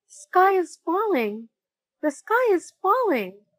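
high, panicky cry of a frightened chick; high-pitched frightened squawk; panicked cry. Acted. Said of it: "he cried".

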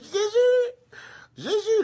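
high, loud mock wail for help; panicked cry. Bitten evangelist calling on Jesus, panicked mock wail. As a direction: cry out in hammed-up terror. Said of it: "le gars même prie".

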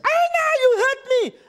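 high shrieking demon cry; demon in pain. Retold or imitated. Demon crying out as the preacher drives it out.

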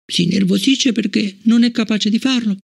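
put-on high young girl's voice, hurt and puzzled; a child asking why she is disliked; child Fallon. Fallon asking Bliss about Travis, high child voice.

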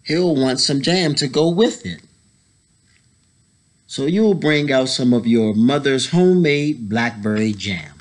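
ordinary delivery

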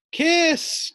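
high ringing call across the room. Acted. Teacher calls a pet onto the stage, calling out. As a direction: call the pet's name up to the stage. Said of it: "called Mister Small".

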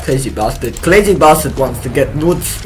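ordinary talk, nothing marked

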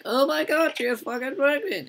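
over-the-top high squeal of shock; mock shriek. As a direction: shriek it like a hammed-up gossip. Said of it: "And it's like".

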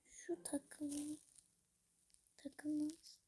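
breathy whisper under the breath; whispered aside. Real, not acted. Muttering to herself over clashing outfit pieces, whispered. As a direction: whisper it to yourself, annoyed. Grumbling aside while dressing avatar.

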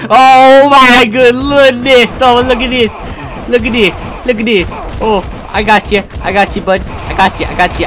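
high, loud gamer yelling, easing gradually; frantic excitement. Real, not acted. Gamer fighting off a swarm of zombies, frantic excited yelling. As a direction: yell through the fight in rapid repeats.

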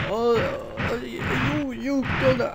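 high, halting old man attempt; failed old man voice. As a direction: stumble through a bad old-man impression. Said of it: "I can't even do it now".

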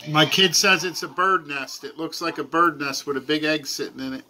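normal talking voice

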